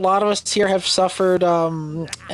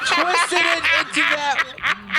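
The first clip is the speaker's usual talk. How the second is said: laughing; 12 semitones higher than usual. Real, not acted. Teasing friend over innuendo.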